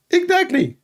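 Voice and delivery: high mocking preacher voice; squeaky sermon mimicking a preacher; Ali Dawa. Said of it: "Ali Dawa".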